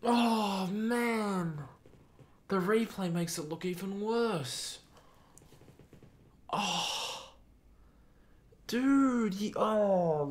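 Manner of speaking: high, strained despair; anguished groan. Real, not acted. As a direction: agonize over the miss. Reacting to missed chance.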